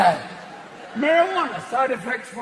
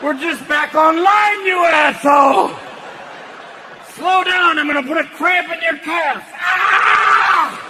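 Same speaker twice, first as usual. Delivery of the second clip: higher, louder raging shout; furious heart; 5 semitones higher, 4 dB louder than usual. Acted. Heart screaming at the penis, furious. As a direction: scream at him to slow down. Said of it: "your heart's going".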